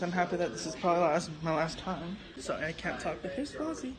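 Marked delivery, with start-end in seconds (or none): 3.3-3.9 high cooing to a pet. Real, not acted. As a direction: coo sweetly at the dog.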